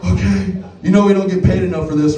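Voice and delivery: ordinary delivery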